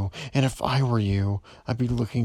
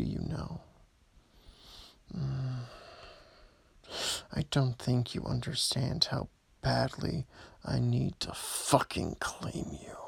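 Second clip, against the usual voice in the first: ordinary delivery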